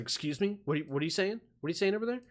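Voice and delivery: a touch of huffy "excuse me" challenge; lightly voiced affronted bystander; indignant lady. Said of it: "She's like".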